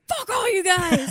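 loud, high mock rage; pissed-off shout. Maury at his surprise party, pissed-off shout. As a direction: yell it at the whole room. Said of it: "He's so pissed off".

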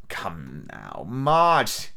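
low, cold beggar's command; cruel cold voice. A narrator's reading. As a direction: bark a cold order. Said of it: "a voice so cruel and cold and ugly".

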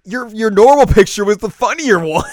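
loud, high teasing jab; a loud, high-pitched teasing voice; teasing Ritsu. Retold or imitated. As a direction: tease her loudly about the photo. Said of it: "Ritsu's like".

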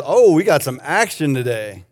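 ordinary talk

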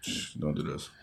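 low, barely voiced shushing; hushed plea. Real, not acted. Podcaster shushing his friend off the [name] topic, hushed plea. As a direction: hush him under your breath.

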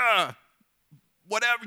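mock carefree shrug; dismissive. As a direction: toss it off like freed slaves leaving. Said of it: "words that they, we probably couldn't say in church".

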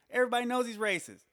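fast, high and loud, as if it's obvious to everyone; exasperated. Real, not acted. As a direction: blurt it out in disbelief. Exasperated comic rant.

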